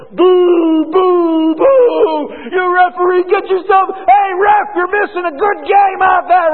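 loud jeering boos at the ref; crowd booing. Retold or imitated. Preacher imitating stadium fans booing the referee, loud jeering shout. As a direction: yell like an angry crowd at the referee.

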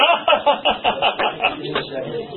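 high laugh tacked onto a joke; mock guffaw. Laughing off a jibe at someone, hammed-up guffaw. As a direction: laugh it up at your own insult.

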